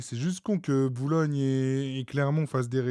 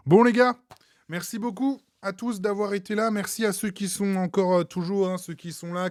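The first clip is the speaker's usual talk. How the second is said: cheerful sign-off; 7 semitones higher than usual. Real, not acted. Streamer signing off to viewers.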